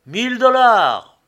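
high, very loud drawn-out bidding shout; loud cry. A narrator's reading. Hatter bidding at a crowded auction, loud cry. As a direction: shout the bid out across the room. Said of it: "s'était écrié d'une voix forte".

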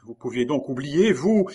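high, loud, hurt question; crying out. A narrator's reading. Geneviève in tears at Maurice's words, crying out. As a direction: cry out, leaping up on the last word. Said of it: "s'écria Geneviève".

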